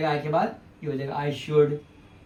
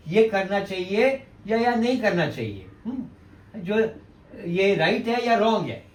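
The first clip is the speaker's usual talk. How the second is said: ordinary talk, nothing marked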